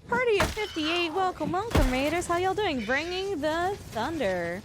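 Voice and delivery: high, thrilled hype welcome; excited raid greeting. Real, not acted. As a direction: greet the arriving crowd with delighted surprise. Streamer greets an arriving raid.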